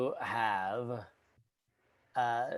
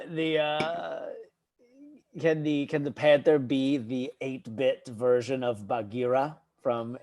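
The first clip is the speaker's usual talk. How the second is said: normal talking voice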